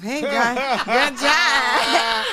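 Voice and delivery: loud, high, hammed-up cheer; mock self-praise. Artist replying to own fake page, mock self-praise. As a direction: parody someone congratulating themselves, near laughter.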